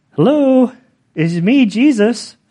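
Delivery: high, lilting comic hello; Jesus, playfully voiced. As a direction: announce yourself in a teasing singsong. Jokingly voices Jesus revealing himself.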